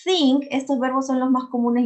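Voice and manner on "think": "Think" loud and high, crisply modelled; pronunciation drill. Shown as a demonstration. Teacher modelling a verb for students, pronunciation drill. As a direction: model "Think" clearly with a th sound. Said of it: "Yo los voy a pronunciar".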